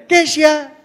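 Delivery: loud, high call of the name Keisha. Retold or imitated. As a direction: call the child across the room. Calling out to sulking child.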